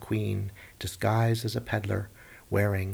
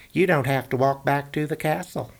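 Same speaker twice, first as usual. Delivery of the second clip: high, louder hunter; 5 semitones higher, 5 dB louder than usual. Acted. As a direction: deliver the bad joke brightly. Hunter speaks.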